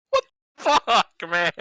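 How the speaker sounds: high, loud, drawn-out disbelief; stretched, high-pitched indignant yell; exasperated outburst. Real, not acted. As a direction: protest loudly at being teased. Player teased by the table.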